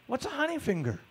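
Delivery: high-pitched, puzzled retort; baffled question. Retold or imitated. Student asking the teacher about an unknown term, baffled. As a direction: ask it high, totally lost. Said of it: "I had no idea what that was".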